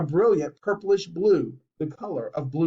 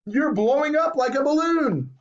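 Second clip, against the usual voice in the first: alarmed screech; 9 semitones higher than usual. A narrator's reading. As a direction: keep shrieking in alarm. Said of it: "screeched Missus Beauregard".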